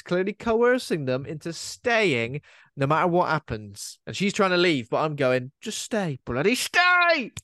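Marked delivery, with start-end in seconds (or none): 5.6-7.3 pleading that leaps into a high, drawn-out cry; beg, then wail the last words; desperate plea. Acted. Singer coercing someone into staying, desperate escalating plea.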